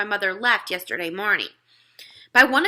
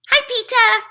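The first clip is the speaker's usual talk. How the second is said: much higher, louder bright hello; gleeful toddler; 9 dB louder than usual. A narrator's reading. Fudge pops out and greets his startled brother. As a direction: pop out cheerfully. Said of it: "he said".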